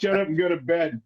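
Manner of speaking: a hint of a fed-up mom; dismissive mom. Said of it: "And she's like".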